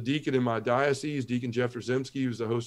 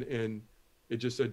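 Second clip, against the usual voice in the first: usual speaking voice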